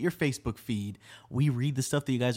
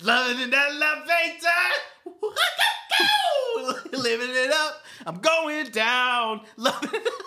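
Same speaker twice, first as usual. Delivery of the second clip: high, cackling rock-star voice; 12 semitones higher than usual. Acted. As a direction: keep hamming up the rock singer, laughing through it. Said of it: "I can do a good [name] impression".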